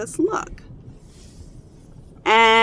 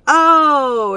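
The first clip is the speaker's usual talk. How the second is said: high, loud child's "oh", imitated; delighted realization; 9 semitones higher, 13 dB louder than usual. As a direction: imitate a kid suddenly getting the joke. Son suddenly understands, starts laughing.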